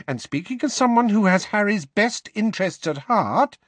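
high, insistent Mrs. Weasley; high-pitched protesting mother; indignant mother. A narrator's reading. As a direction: argue your case, pitched high and tense. Heated argument over Harry.

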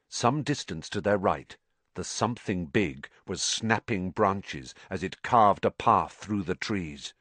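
usual speaking voice

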